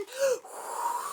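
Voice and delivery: sharp gasp. Acted. Wind scene gasp.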